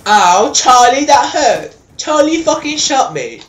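high, loud cry of complaint; pained shouting. Real, not acted. Gamer protests that Charlie shot him, pained shouting. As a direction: yell out as if just hit.

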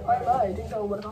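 ordinary talk, nothing marked

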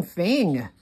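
ordinary delivery

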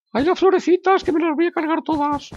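high falsetto fretting; comic high-pitched dismay; mock distress. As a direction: fuss over it in a squeaky voice. Mock distress over the flowers.

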